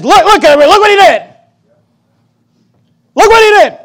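high, loud taunting accusation; accusing devil. Retold or imitated. The devil pointing at the sinner, loud mocking taunt. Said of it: "he points at you and says".

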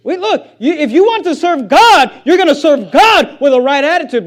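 high, loud pulpit exhortation; emphatic, ringing preacher's delivery; full-force preaching. Real, not acted.

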